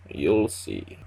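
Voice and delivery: put-on a low, mysterious Russian accent, closing the talk; cryptic Russian accent. Vasily ending the conversation cryptically, in a low Russian accent. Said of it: "in a floaty Russian accent".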